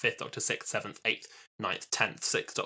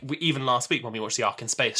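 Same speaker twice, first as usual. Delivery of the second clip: usual speaking voice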